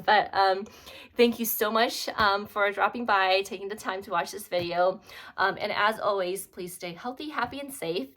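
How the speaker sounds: usual speaking voice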